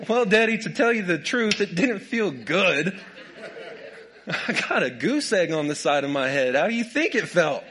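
put-on a hurt kid's retort, giggling through it; laughing kid's reply. Preacher voicing his childhood self answering his dad, laughing. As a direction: answer Daddy with laughing sarcasm. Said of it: "How'd that feel?".

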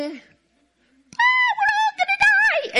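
very high-pitched, hammed-up shriek of terror; shrill comic wail of panic; mock panicked scream. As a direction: scream like doomed disciples in a storm. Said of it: "and the disciples screaming".

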